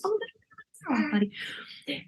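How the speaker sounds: soft whispered reassurance. Real, not acted. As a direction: hush a little one gently. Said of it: "whisper aside to child".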